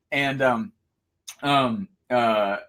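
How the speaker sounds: ordinary talk, nothing marked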